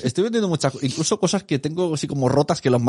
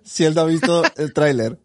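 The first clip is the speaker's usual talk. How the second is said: mock inner voice; 7 semitones higher, 3 dB louder, slower than usual.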